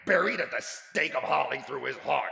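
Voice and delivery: high, loud indignant rant. Acted. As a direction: spit out the threat furiously. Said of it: "said Scrooge indignantly".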